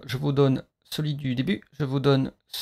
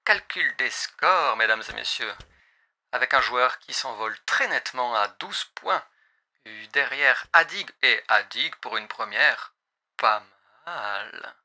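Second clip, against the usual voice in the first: pilot radio voice over headset; pilot cockpit headset. Acted. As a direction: speak like a cockpit announcement. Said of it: "la voix de pilote".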